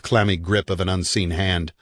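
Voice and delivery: usual speaking voice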